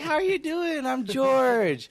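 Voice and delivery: imitating a hearty greeting. Mimicking George introducing himself to newcomers, over-the-top and high. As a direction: boom out a mock introduction in a high voice.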